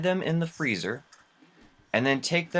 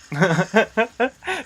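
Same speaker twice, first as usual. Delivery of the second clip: high-pitched burst of laughter; real laugh; 10 semitones higher than usual. Real, not acted. Host laughs after mortician bit, amused. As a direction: crack up at your own joke.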